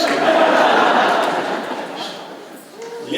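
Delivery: a long loud breathy laugh; hearty laugh. Real, not acted. Speaker laughing at his own story, loud and long. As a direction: laugh out at the funny picture.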